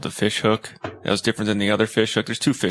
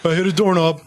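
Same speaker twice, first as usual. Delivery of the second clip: higher-pitched mock explanation; rambling excuse in a raised pitch; acted-out excuse; 5 semitones higher than usual. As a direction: play someone explaining away an injury at work. Acting out an excuse at work.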